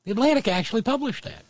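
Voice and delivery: high, fast disbelief; incredulous. Real, not acted. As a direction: sound like you can't believe it. Incredulous reaction.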